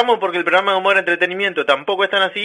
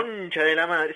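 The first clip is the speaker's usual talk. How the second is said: frustrated aside, much quieter; muttered curse; 9 dB quieter, slower than usual. Acted. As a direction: swear under your breath, much softer. Caller swears under breath.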